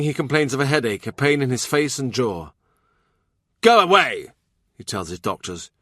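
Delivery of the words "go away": irritable shout. Acted. King in pain sends doctors off.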